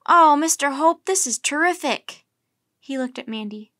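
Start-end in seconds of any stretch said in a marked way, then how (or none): 0.0-2.2 loud, high burst of delight; thrilled. A narrator's reading. Boy thanking friend's grandpa for go-kart plans, thrilled. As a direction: gush with excited gratitude. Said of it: "his face shining".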